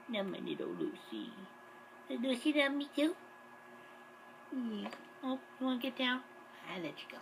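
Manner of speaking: soft, hushed cooing to the cat; pet talk. Real, not acted. Vlogger cooing softly to his cat Lucy. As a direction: murmur tenderly to your pet.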